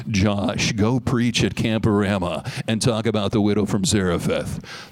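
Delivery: deep, booming announcer voice from above; radio announcer God. Acted. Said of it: "God sounds like a radio announcer".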